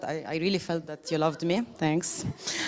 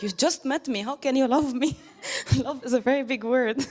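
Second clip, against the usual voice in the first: higher, half-laughing mock protest; laughing disbelief; 6 semitones higher than usual. To people who hug strangers, laughing disbelief. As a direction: retell it through giggles, amused. Retelling her reaction to strangers' love.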